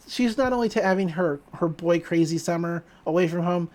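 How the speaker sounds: ordinary talk, nothing marked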